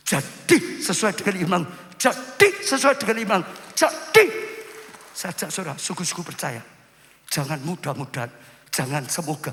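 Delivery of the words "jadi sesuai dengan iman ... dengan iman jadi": declaration rising to a loud, high peak; commanding proclamation. Acted. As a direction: build each command louder and higher. Jesus's command, repeated building. (seruan perintah yang memuncak)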